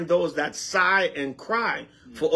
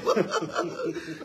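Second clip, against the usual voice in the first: breathy laugh; 6 dB quieter than usual. Real, not acted. Laughing at [name] story.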